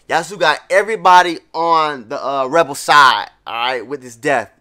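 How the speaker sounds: excited. Real, not acted. Hyped reaction to story twist.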